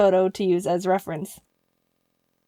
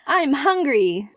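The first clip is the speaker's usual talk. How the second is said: slow slogan pitch; 3 semitones higher, much slower than usual. Retold or imitated. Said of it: "So I suggested".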